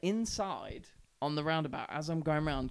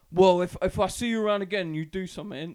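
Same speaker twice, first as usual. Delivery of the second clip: loud threat; 4 semitones higher, 10 dB louder than usual. Retold or imitated. Biker threatens him.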